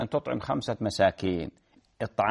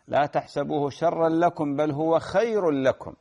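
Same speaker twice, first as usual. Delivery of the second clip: slow Quran recitation; 4 semitones higher, slower than usual. Acted.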